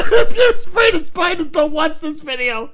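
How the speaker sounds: high laughing shriek; laugh-scream a warning; shrieking laughter. Real, not acted.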